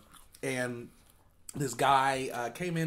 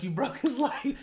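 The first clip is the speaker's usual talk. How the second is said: much higher, half-laughing mock scolding of the manager; mock irate; 11 semitones higher than usual. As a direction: play the angry boss but crack up. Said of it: "He was irate. The guy was pissed off".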